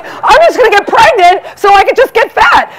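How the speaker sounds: hammed-up cheerful scheming; mock-giddy plan. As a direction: chirp it like a brilliant idea. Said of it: "So I'm like".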